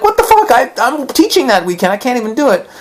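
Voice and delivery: mock-panicked at a double booking; outraged disbelief. Retelling his reaction to her booked tickets, outraged and high. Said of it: "I'm like".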